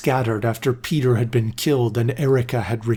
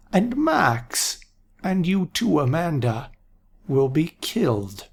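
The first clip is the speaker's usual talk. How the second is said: put-on higher, slow ominous old man; old seer voice; 5 semitones higher than usual. A narrator's reading. Aaron warning the council, slow old voice. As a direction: foretell it gravely.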